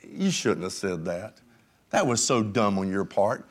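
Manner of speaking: low, soft, stern voice of rebuke; Holy Spirit rebuke. Retold or imitated. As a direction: drop low and scold gently. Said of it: "will say".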